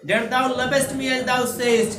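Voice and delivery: slow, drawn-out reading of verse; poem recitation. Acted. Teacher reciting the English poem to the class, slow and solemn. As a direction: recite each line slowly and solemnly. Reads poem line aloud.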